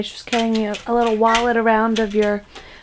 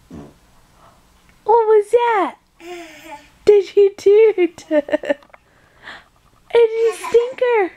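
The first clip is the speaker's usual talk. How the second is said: playful teasing; 7 semitones higher, slower than usual. Real, not acted. Teasing baby about a toot.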